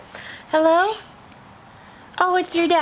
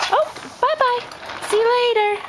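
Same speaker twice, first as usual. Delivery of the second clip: singsong goodbye; 12 semitones higher than usual. Real, not acted. Singsong farewell to baby.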